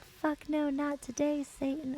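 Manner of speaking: high put-on refusal; high imitated character voice; acted character quote. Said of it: "she's like".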